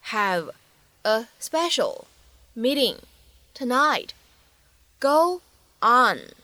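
slow, isolated words with pauses between. Shown as a demonstration. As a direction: say it one word at a time, deliberately. Teacher models line word by word. (一字一顿地慢速示范朗读)

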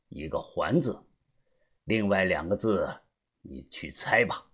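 soft, low elder's undertone; hushed, low character voice, withholding the answer; speaking in a low voice. A narrator's reading. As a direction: wind the talk down quietly. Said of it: "他看着我小声地说道".

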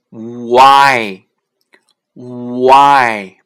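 loud "Why" drawn out from oo into eye, silent h; slow pronunciation demo. Shown as a demonstration. Teacher showing learners the sounds in why, loud stretched demonstration. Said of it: "我们只要发U和I的音".

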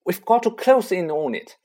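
calling. A narrator's reading. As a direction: call across the room. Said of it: "Harry called".

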